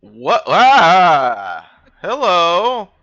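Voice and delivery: loud delighted shout; excited. Real, not acted. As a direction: call out in triumph. Finds hidden ship.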